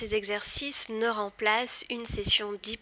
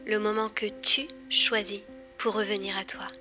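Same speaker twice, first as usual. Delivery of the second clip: breathy close-mic hypnosis teaser; right in your ear. Acted. Intro to hypnosis series.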